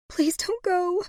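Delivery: high, tearful begging; sobbing plea. A narrator's reading. Woman cradling her dying lover, high sobbing plea. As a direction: beg him through tears, pitched high. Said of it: "she sobbed".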